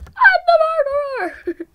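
loud, high, triumphant cry; joyful shout. Real, not acted. Gamer shouting in joy at being the murderer. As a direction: burst out with delight.

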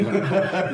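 short high burst of laughter. Real, not acted. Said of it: "Il parle pas comme ça".

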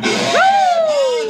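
loud, high sudden shriek; startled scream; scream of fright. Real, not acted. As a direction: yelp in shock. Sudden scare.

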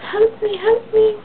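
high, slow, pleading help; hammed-up high plea; mock cry for help. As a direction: squeak it out like a helpless hostage. Frank voiced as hostage.

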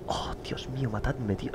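tired whisper to himself; weary whisper. Real, not acted. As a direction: breathe it out like you're exhausted. Tired late-night recording aside.

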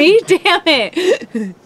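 high, drawn-out mock curse; cursing creator. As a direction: play God swearing at himself, stretched out and high. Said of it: "He's like".

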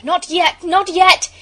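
loud, high, rising shout; urgent cry. A narrator's reading. Bobby holding her place before the engine, urgent cry. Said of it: "But Bobby cried".

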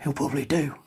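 leaning in, hushed and low, voiced not breathy; a hint of a conspiratorial murmur; mild mock whisper. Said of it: "just leans in and, uh, whispers".

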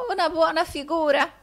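high-pitched incredulous echo; an old housekeeper exclaiming back; exclaiming old woman. A narrator's reading. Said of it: "esclamò Margherita".

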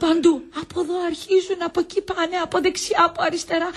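high, whining patient's complaint; put-on invalid's wail, held high; feigned heart attack. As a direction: moan through pretended pains. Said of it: "Έχω ανάγκη από μια καρδιακή κρίση".

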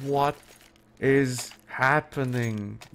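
very slow, with a long pause after "What"; disbelieving, word by word. Real, not acted. Caster repeating it to himself, slowly disbelieving. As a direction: separate "What", "is", "happening" in disbelief.

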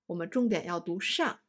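ordinary talk, nothing marked

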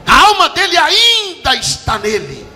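slow, high, loud proclamation; drawn-out preacher's shout; proclaimed shout. Real, not acted. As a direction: stretch each word out as you shout.

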